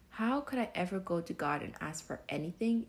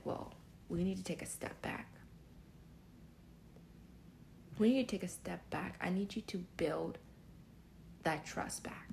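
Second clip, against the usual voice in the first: whispering; 6 dB quieter than usual. Retold or imitated. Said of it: "And he said".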